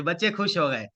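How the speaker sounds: normal talking voice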